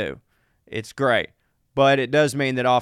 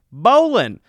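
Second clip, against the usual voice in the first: excited announcement; 7 semitones higher, 7 dB louder than usual. Acted. Reading the email's topic.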